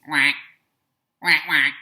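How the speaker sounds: loud duck quacking; duck quacks. Shown as a demonstration. Teacher playing the yellow duck before its answer, loud quacks.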